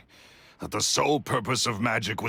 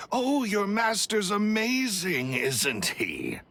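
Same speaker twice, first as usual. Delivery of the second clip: much higher, slow, sing-song imitation of a fawning fan; mocking gushing admirer; 11 semitones higher, slower than usual. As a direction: parrot the fans' gushing praise with contempt. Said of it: "All I ever heard was".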